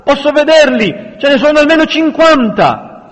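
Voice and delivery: very high, loud alarm; frantic, high-pitched warning; breathless panic. A narrator's reading. Neri sees fifty men coming, panicked. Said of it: "disse senza fiato".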